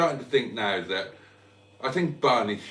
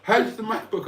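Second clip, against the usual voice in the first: quoting Joe; 10 semitones higher, 3 dB louder than usual. Retold or imitated. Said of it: "asked Joe".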